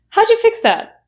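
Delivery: How'd you fix that with howja, pitch lifted; reduced question. Shown as a demonstration. Asking a colleague about the copier, reduced. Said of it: "you can ask".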